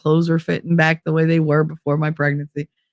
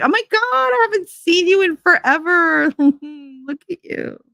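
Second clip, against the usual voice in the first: high, syrupy fake delight; gushing acquaintance; 10 semitones higher than usual. Old acquaintance greeting her on the street, high gush. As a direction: play the overjoyed old acquaintance. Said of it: "And everybody be like".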